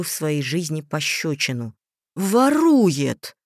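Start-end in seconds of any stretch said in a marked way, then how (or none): 2.2-3.4 furious roar, higher and louder. A narrator's reading. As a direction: roar it like an enraged father. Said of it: "взревел побагровевший папа".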